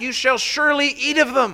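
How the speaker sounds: SURELY driven home, high and loud; emphatic. Acted. Preacher quotes God's permission to Adam, emphatic on "surely". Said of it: "it's emphatic".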